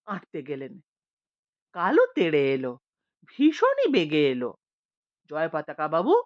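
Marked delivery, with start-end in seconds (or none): none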